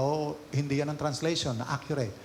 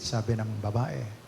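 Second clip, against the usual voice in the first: normal talking voice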